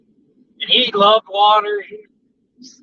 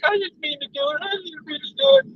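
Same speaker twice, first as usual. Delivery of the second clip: high-pitched pleading dog apology; mock-sorry puppy voice, high; sad dog; 11 semitones higher, 4 dB quieter than usual. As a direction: plead like a dog caught misbehaving. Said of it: "they look at you all sad".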